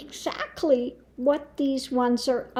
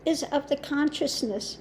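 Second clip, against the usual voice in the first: ordinary delivery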